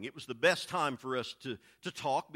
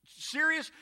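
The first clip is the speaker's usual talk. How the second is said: ordinary delivery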